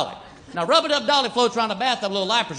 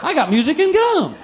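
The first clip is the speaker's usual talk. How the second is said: delighted dimwit; 6 semitones higher than usual. Retold or imitated. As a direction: gush over the find like a happy fool. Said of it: "somebody opened that box and went".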